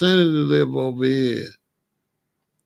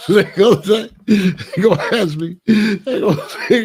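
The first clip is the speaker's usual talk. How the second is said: laughing; 4 semitones higher, 6 dB louder than usual. Real, not acted. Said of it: "laughing while speaking".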